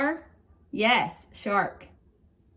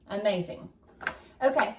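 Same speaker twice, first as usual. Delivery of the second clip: ordinary talk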